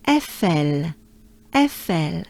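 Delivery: Eiffel as eh-fel, two E sounds; eh-fel with smiling French e. Shown as a demonstration. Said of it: "twice the sound E".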